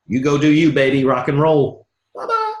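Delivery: loud, sing-song goodbye; breezy brush-off. Pretend. Coach brushing off an unwilling prospect, breezy. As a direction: wave them off cheerfully.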